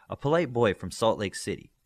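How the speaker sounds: ordinary delivery, nothing marked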